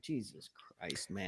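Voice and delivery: retold hushed disbelief; quiet dismay. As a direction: say it under your breath, appalled. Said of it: "I'm like".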